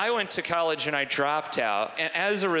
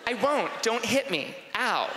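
put-on high, girly protest; put-on girlish squabble; play-fighting girl; 7 semitones higher than usual. Girls play-fight in imagined dorm.

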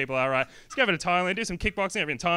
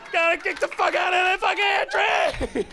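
sob-shout it like a broken man; hammed-up tearful bellow at a tree; crying shout; slower than usual. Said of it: "He's, like, crying".

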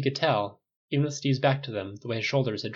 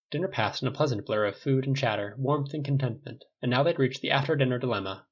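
plain reading voice

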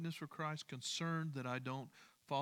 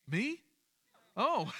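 much higher, louder startled "Me?"; startled surprise; 8 semitones higher, 5 dB louder than usual. Real, not acted. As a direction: jump up in pitch, caught off guard. Caught off guard by a listener's reaction.

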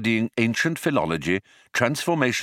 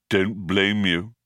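lowered sluggish machine voice; gloomy robot; slower than usual. Acted. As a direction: drag it out in bored disgust. Marvin answers.

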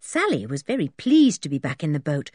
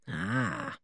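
very low, soft, knowing hum; profound; 11 semitones lower, 10 dB quieter than usual. A narrator's reading. Policeman weighing the children's report, profound. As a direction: let it out deep and weighty. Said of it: "said the policeman profoundly".

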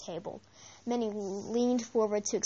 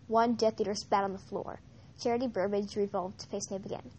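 plain reading voice

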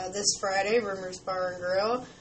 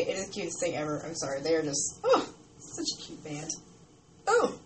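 hiccuping between words; with hiccups; 5 semitones lower than usual. Real, not acted. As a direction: talk through the hiccups. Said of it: "I have the hiccups".

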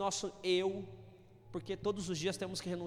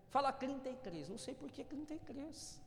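doctor's request; 4 semitones higher, 8 dB quieter than usual. Retold or imitated. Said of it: "quando eu ia no médico".

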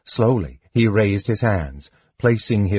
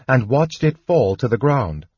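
ordinary delivery

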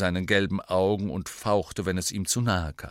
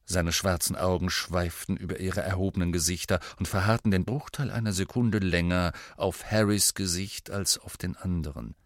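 plain reading voice

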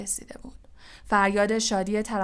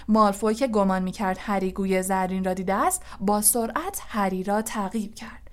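ordinary delivery

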